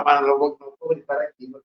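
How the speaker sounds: ordinary talk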